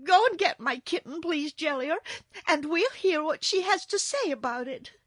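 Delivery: high, loud, urgent request; upset little girl. A narrator's reading.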